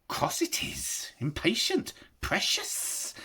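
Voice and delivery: high hissing whisper; hissed. A narrator's reading. Gollum hissing back at impatient Bilbo, high whisper. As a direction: hiss it like a cornered creature. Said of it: "hissed Gollum".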